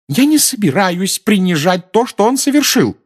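very high, loud, agitated cry; shouting. A narrator's reading. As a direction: shout back in a high, strained protest. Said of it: "закричала миссис Уизли". (крича высоким голосом)

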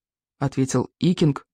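ordinary delivery

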